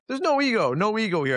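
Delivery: high, hammed-up parroting of a gym slogan; mock gym cliché. Coach mocking the gym saying that there is no ego, high and hammed-up. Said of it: "my favorite statement".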